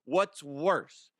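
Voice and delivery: ordinary delivery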